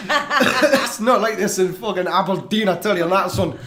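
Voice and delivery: put-on slow Scottish accent, laughing; jokey Glaswegian hard man. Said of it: "That is not an Aberdonian accent".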